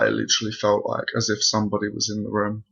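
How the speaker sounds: ordinary talk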